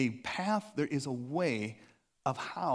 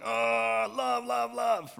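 higher, louder straining for love; forced shouting love; 8 semitones higher, 4 dB louder, much slower than usual. Pretend. Pastor mocking forcing love by straining, loudly. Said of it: "you're like".

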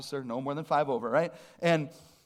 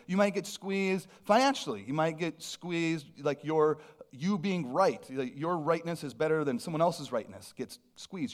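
ordinary talk

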